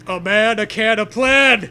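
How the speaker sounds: loud punchy wordplay; excited riff. Real, not acted. Riffing with the co-host, loud and gleeful. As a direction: shout the rhyme with glee.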